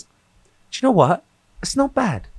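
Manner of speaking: high, slow mock British voice; a lightly voiced British woman impressed; British accent. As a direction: imitate a posh British friend's verdict. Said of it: "she just goes".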